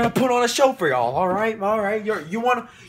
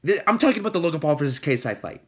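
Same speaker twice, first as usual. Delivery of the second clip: ordinary talk, nothing marked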